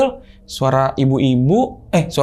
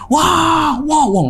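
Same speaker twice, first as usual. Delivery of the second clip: high-pitched mock raging shriek; raging scream; 13 semitones higher, much slower than usual. Possessed girlfriend reacting to prayers, raging high cry. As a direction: imitate a possessed girl's wild wail. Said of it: "ngamuk, ngamuk, ngamuk".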